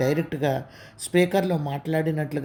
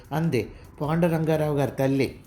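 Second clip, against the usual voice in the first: ordinary delivery, nothing marked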